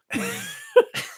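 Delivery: eagle screech. Pretend. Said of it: "It wasn't my best work".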